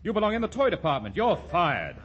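high, clipped boss firing off orders; brisk boss voice. Shown as a demonstration. A boss barking orders at a clerk, high and brisk. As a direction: rattle off commands like a blustering manager. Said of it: "someone who could talk like this".

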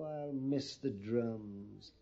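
low, soft, drawn-out regret; wistful lament. Acted. Pliny mourning the silent regimental band, wistful lament. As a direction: sigh it out slowly and low.

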